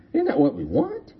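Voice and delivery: "Isn't" lifted high and said softly as a question; high soft repeat. Shown as a demonstration. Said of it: "And isn't that what we want?".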